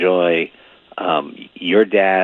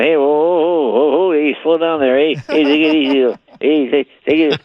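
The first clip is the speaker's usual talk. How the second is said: mock nervous Norm; 6 semitones higher than usual.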